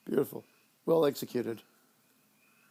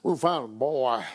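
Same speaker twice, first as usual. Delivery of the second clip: lower, flat deadpan line; imitating the sheriff; 4 semitones lower than usual. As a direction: say it dry like the sheriff. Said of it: "He doesn't say it like that at all".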